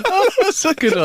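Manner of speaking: high, bright through laughter; laughing. Real, not acted. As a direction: talk while still cracking up. Still laughing at punchline.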